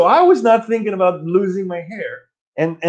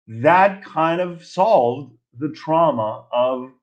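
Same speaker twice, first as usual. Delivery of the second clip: ordinary talk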